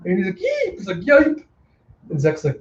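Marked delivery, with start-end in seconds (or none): 0.4-0.7 tiny high-pitched squeak; very high startled yeep; frightened yelp. Acted. Clown has gun pointed at him. 1.0-1.4 high cartoon yelp; sharp high squawk of alarm; startled yipe. Clown startled by gun.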